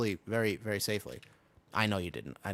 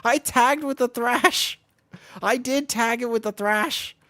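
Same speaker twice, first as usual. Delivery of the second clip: much higher, louder, slow gleeful bragging; excited laughing brag; 12 semitones higher, 7 dB louder than usual. Real, not acted. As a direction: crow over the big hit with a laugh.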